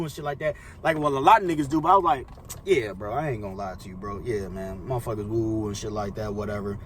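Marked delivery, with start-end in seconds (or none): 2.6-6.8 low, soft retold admission; understated, lowered acted confession; subdued confession. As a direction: own up quietly, voice dropped. Acts out own confession to friend.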